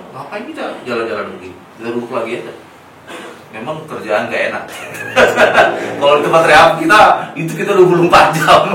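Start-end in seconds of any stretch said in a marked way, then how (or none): 4.7-5.9 high, loud burst of laughter; real laughter. Real, not acted. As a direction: laugh openly at the hard work. Laughs at the job.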